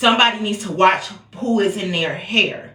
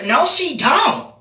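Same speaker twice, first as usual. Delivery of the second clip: indignant; 8 semitones higher, slower than usual. Real, not acted. Reacting to the clip, slow indignant high denial. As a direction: drag it out in disbelief. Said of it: "reacting to the video".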